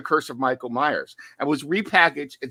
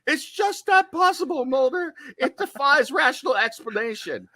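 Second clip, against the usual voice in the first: high-pitched mock skeptic, breaking into a laugh; Scully impression; 11 semitones higher than usual. Podcaster imagining Scully protesting to Mulder, high mock-Scully voice. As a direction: squeak out disbelief in a put-on falsetto. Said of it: "Can you imagine Scully seeing Michael tank some shots and saying".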